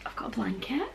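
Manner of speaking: soft voiceless whisper, voice gone; whispering from lost voice. Real, not acted. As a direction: whisper it, you can barely speak. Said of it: "Apologies for the voice".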